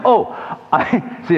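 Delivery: high, surprised laugh at himself; real laugh. Real, not acted. Preacher laughing at himself mid-sermon, high. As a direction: laugh it off, caught out. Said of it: "It really got me".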